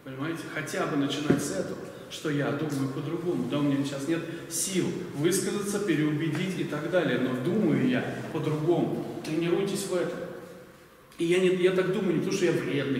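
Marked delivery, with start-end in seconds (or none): none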